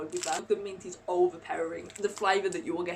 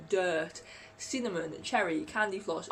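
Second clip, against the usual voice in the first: ordinary talk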